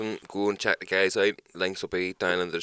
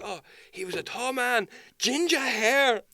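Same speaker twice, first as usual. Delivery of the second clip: much higher, slow on ginger hair; mock takeaway worker; 4 dB louder, slower than usual. Worker describes the thief to the fake cop, high and slow. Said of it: "It's like".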